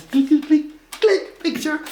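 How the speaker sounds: high, loud click click of a camera; playful imitated camera clicks. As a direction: chirp out the clicks like a shutter. Wife snapping photos.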